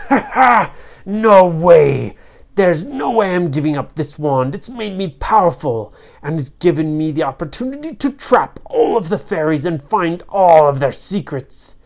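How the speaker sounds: laughing villain refusal, loud at first; gloating storybook villain; evil sorcerer, defiant. Acted. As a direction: scoff and boast of your power.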